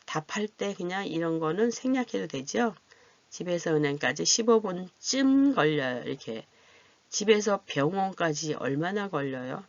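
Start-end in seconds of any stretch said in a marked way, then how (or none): none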